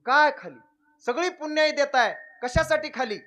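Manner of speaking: high, loud astonished question; god's loud high astonishment; surprised god. Retold or imitated. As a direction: ask in disbelief.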